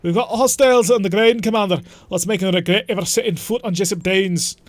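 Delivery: loud, hammed-up battle report; mock-heroic military rallying call; soldier reporting. Playing a soldier reporting to commander.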